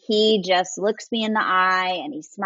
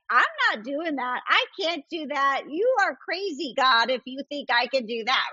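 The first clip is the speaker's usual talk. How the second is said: mock-protesting Moses, pitched higher; 6 semitones higher than usual. Moses arguing back at God, a hammed-up higher protest. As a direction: play Moses refusing God, incredulous and higher. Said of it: "tells God, like".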